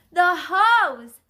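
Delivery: high, loud shout. A narrator's reading. Bystander calls for water on the dogs, shouting. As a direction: shout it out urgently. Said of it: "someone shouted".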